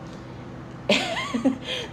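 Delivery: bright burst of laughter. Real, not acted. Laughs at own gossip.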